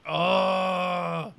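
high exasperated groan at a bore; annoyed groaning. Retold or imitated. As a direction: sigh it out, fed up. Imitating annoyed viewer.

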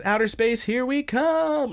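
high-pitched mock launch cry, last words drawn out; imitated excited cry. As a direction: proclaim it high like a cartoon hero, stretching the end. Quoting a character's triumphant call.

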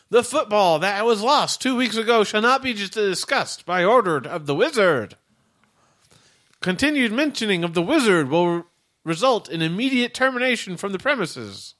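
high stiff robot announcement; robot warning. Acted. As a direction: recite the rule like a machine. Robot issues formal warning.

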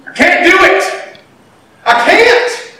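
half-acted desperate plea; pleading refusal. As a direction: protest like a scared child. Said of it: "that's how we are with God sometimes".

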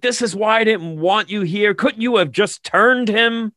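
high-pitched mock yelling, retelling a scolding; berating. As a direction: act out an exasperated telling-off, pitched well up. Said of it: "he starts yelling and berating".

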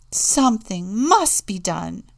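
loud, emphatic demand; exclaiming. A narrator's reading. Contessa demanding action against the wolf, exclaimed. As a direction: insist on it firmly and loudly. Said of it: "screamed Contessa de Gubbio".

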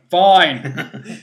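loud mock surrender; hammed-up, exasperated outburst; exasperated giving in. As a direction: burst out as if worn down by nagging. Acted giving in to nagging.

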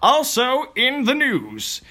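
high, drawn-out segment announcement; mock news announcer. Podcast host opening the news section, mock announcer.

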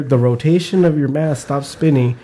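ordinary delivery

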